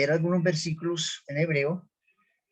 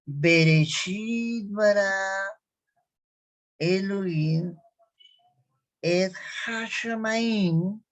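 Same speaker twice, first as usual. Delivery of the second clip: slow, raised, stretched-out Hebrew recitation; slow model reading; 4 semitones higher, much slower than usual. Shown as a demonstration. Teacher repeating the verse for students, slow and drawn out. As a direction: stretch each word out for learners to repeat. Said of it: "entonces repetimos de nuevo".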